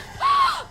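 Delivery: a big high gasp of air; loud gasping inhale. Acted. Dinosaur obeys the breathing cue, sharp gasp. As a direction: suck in a dramatic breath.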